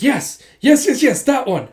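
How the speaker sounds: high, loud excited yeses; acted-out burst of excitement; overeager confirmation. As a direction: pounce on it with glee. Retold accuser spots planted evidence.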